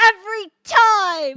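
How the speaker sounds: high, very loud drawn-out shout; big cartoon holler. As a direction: yell it out in character, stretching the words. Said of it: "Happy shouts".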